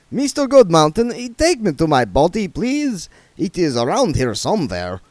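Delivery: mock the joke with icy sarcasm; offended vampire playing it dry; sarcastic vampire Otto. Otto asks for his body.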